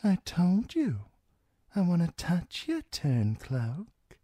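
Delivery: high-pitched character voice; sly high-voiced woman; menacing washerwoman. A narrator's reading. Washerwoman Holly threatens Theon, high character voice.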